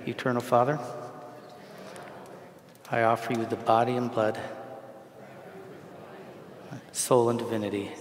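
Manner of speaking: prayer fed out in short phrases with long pauses; slow lead-in prayer. Shown as a demonstration. Priest has congregation repeat after him, slow and halting. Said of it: "repeat it after me".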